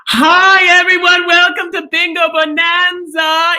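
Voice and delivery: high, loud excited greeting; excited shout. Acted. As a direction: burst in thrilled. Bursting on to greet audience.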